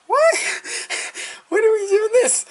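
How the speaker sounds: hammed-up startled dad with a laugh; disbelieving dad. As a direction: play dad's shocked squeak of surprise.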